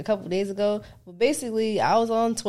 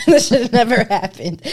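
said through laughter, louder; laughing; 7 dB louder than usual. Real, not acted. Laughing while saying.

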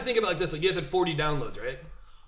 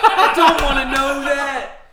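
higher, louder, slow laughing plea; 8 semitones higher, 11 dB louder, slower than usual. Real, not acted. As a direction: crack up while begging. Repeats louder through laughter.

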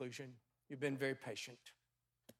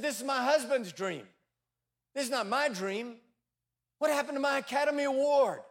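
self-pitying wife; 7 semitones higher, 5 dB louder than usual. Acted. Bitter wife's lament in story.

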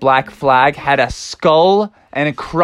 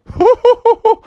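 high giggle; 4 dB louder than usual. Acted. Otter laughs.

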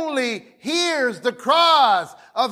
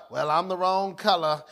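mock timid excuse; 6 semitones lower, 6 dB quieter than usual. Preacher mocking a hesitant congregant, low and soft. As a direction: lower and softer, sheepish.

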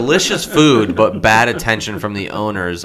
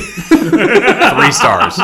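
laughing; 12 semitones higher, 8 dB louder than usual. Real, not acted. Laughing at review.

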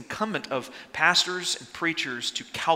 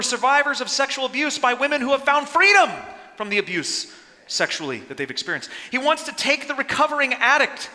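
rising, louder preacher's call; fiery preaching; 7 semitones higher, 3 dB louder than usual. Acted. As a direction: preach it with full force. Builds to a rousing climax.

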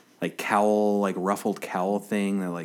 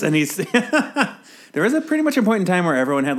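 laughing; 10 semitones higher, 6 dB louder than usual. Real, not acted. Laughing mid-sentence about comic costumes.

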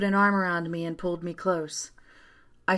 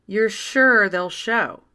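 slow, louder doubtful question; dubious; 3 semitones higher, 6 dB louder than usual. A narrator's reading. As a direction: ask it slowly with clear doubt. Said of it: "Janet asked dubiously".

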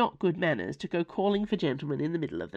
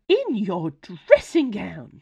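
slow, louder, shocked fussy teapot; scandalized gasp; 3 semitones higher, 7 dB louder, slower than usual. Acted. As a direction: clutch the pearls in outrage. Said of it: "she gasped".

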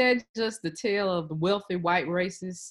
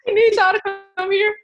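mock plea; 10 semitones higher, 6 dB louder than usual. Mocking Montrose's plea.

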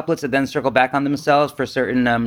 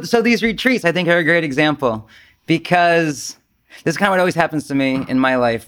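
ordinary talk

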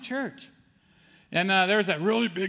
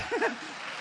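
a short high burst of a laugh; surprised laugh; 13 semitones higher, 6 dB quieter than usual. Retold or imitated. Stunned patient hearing the news from his doctor, a high delighted laugh. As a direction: laugh out in happy disbelief.

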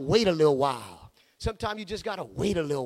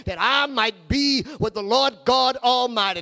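ordinary talk, nothing marked

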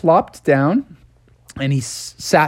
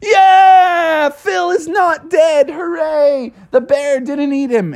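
very high, slow louder hooray; joyful crowd cheer; 11 semitones higher, 4 dB louder, much slower than usual. Acted. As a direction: cheer in relief.

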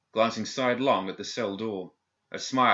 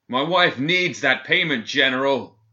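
loud bellow through a door slot; 6 semitones higher, 10 dB louder, slower than usual. A narrator's reading. Prisoner bellowing at the governor through the door. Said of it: "he bellowed through the food pass".

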